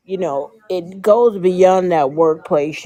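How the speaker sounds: ordinary talk, nothing marked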